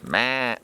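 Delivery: a short high meh grunt; mock grunt. Hunter showing another caller's grunt, short meh. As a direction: bleat it out like a sloppy caller. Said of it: "there's guys".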